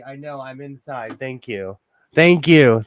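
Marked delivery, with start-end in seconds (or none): 2.1-2.8 louder, mock-grateful; mock-grateful thanks to the buzzing phone; sarcastic thanks. Real, not acted. Thanking phone's flood alert.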